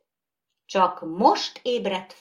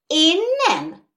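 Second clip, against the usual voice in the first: high, loud protest; indignant cry; 12 semitones higher, 6 dB louder than usual. Acted. Doll refusing to be called an animal, crying out. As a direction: cry out in denial. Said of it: "kiáltott a porcelánbaba".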